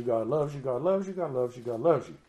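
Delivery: sing-song repeated God loves you, lightly mocking; mocking repetition. Preacher mocking the slogan pumped into churches, sing-song. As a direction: parrot the slogan everyone repeats. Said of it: "pumped this information".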